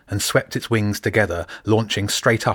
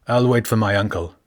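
ordinary delivery, nothing marked

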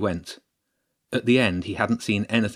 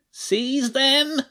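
high, strained rat hiss ordering the attack; hissed rat command; 3 dB louder than usual. Acted. Lead rat orders his horde, hissing. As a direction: spit the order like a nasty rat. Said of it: "he hissed".

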